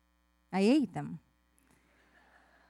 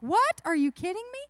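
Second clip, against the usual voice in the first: high-pitched, disbelieving outburst; incredulous kid; 7 semitones higher, 5 dB louder than usual. Acted. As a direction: act out a kid who can't believe it. Acting kids' disbelief at no toys.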